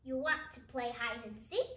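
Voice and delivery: plain reading voice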